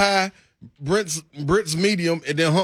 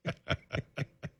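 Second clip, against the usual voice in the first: breathy sinister laugh; evil chuckle; 16 dB quieter than usual. Real, not acted. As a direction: chuckle darkly under the breath. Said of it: "was kind of a sinister laugh by both of us there".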